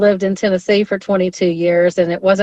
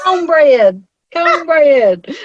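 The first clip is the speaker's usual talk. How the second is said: high, exaggerated Southern twang; Southern accent; 7 semitones higher, much slower than usual. Shown as a demonstration. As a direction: belt it out in a hammed-up Southern accent. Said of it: "Say it with your Southern accent".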